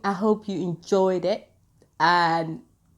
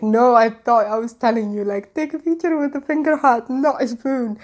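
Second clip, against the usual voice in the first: higher, louder protesting quote; animated retold protest; 5 semitones higher, 5 dB louder than usual. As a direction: act out an exasperated explanation, pitched up. Acted protest over a misunderstanding.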